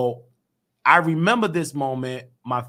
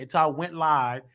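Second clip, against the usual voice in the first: ordinary delivery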